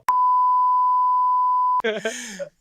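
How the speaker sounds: very high, long held squeal; imitated squeal. Retelling own reaction to co-host, long high squeal. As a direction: let out one sustained high-pitched noise. Demonstrates sound after "I go.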